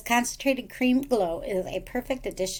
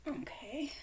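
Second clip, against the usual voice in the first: whisper; 6 semitones higher, 11 dB quieter than usual. Real, not acted. Whispering in awe at necklace.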